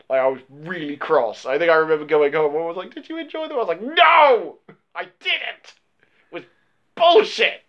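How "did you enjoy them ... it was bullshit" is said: indignant retold outburst.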